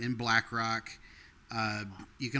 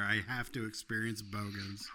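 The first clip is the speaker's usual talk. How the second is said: ordinary delivery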